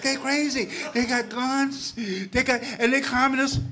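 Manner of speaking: high, loud, rising thrill; a kid getting hyped up; excited teen. Retold or imitated.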